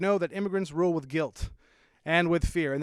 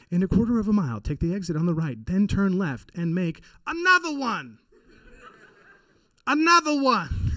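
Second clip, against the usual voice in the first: [name] GPS voice; 3 semitones higher, 3 dB louder than usual. Retold or imitated. Comic sat nav repeating a [name] catchphrase. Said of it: "being the voice of your GPS".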